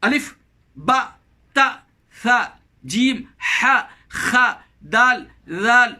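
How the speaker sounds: each Arabic letter named separately and distinctly; drawn-out, carefully articulated letter names with short gaps; letter-by-letter recitation. Shown as a demonstration. Preacher showing listeners the twenty-seven letters, letter-by-letter recitation. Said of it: "ستائیس حروف ہیں".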